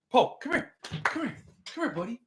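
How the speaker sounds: high sing-song coaxing; dog-calling voice. Real, not acted.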